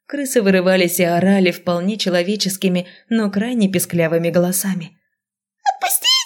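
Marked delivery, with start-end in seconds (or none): 5.6-6.1 tiny rat voice, very high and shrieking; squeaky rat shriek. A narrator's reading. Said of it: "визжала одна".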